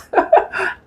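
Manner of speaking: short high-pitched laugh; high little burst of laughter; amused laugh. Real, not acted. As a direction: let out a quick amused laugh. Amused recalling the man's evidence.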